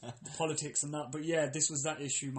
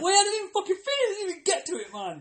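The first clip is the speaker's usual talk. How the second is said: much higher, louder whining sob; mock crying whine; 14 semitones higher, 6 dB louder, faster than usual. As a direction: whine like you're near tears. Retold cut-off frustration.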